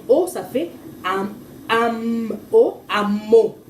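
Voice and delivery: amo built up slowly, am then o; slow blending demo. Shown as a demonstration. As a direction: sound it out for a child, piece by piece. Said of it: "A, M".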